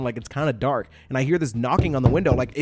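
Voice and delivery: ordinary delivery, nothing marked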